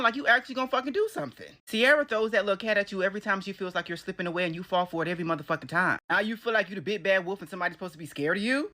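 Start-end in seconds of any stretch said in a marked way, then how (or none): none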